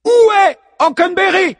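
high, booming demand; goddess shout. A narrator's reading. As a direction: call out as a furious goddess. La muse cherche Hockenberry. (demande criée d'une déesse)

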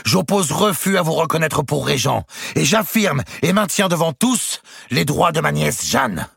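the duke refuses to take another insult; Eudes's indignant outburst. A narrator's reading. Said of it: "dit-il".

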